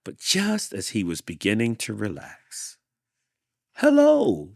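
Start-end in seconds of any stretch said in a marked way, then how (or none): 3.7-4.5 very high, loud hello; shouted hello. Acted. Tortoise shouting hello up at the window. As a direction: call out a big greeting. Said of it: "shouted the tortoise".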